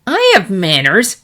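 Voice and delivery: sulky sister talking back; indignant retort. A narrator's reading. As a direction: defend yourself with a pout. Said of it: "countered the Pegasus".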